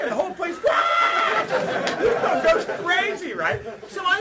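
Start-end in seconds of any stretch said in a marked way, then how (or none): 0.6-1.4 high, loud roar of a crowd; crowd roar imitation. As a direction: roar like the whole club.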